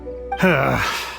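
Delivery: long breathy sigh of defeat; weary sigh. Acted. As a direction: let out a heavy, resigned sigh. Weary reply to a threatening collector.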